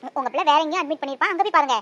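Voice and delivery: loud, indignant rebuke; mother angrily telling the stranger off; angry mother. Acted. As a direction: defend her baby, loud and firm.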